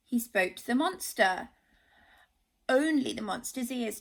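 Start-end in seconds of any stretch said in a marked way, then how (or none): none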